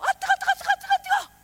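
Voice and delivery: high yelps of mock pain from heat; burning hot. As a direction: squeal like something is scalding you. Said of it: "막 불 받고".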